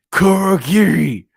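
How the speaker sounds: loud, drawn-out shout in a put-on voice; slow, loud villain summons; commanding call. Villain calls his servant.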